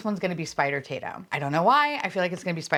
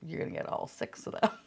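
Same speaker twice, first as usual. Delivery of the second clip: hushed, whispery mock taunt; mock taunting box; 4 semitones lower, 8 dB quieter than usual. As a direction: tease yourself in a sly half-whisper. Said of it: "watch this be like".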